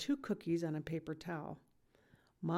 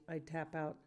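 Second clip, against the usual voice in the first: plain reading voice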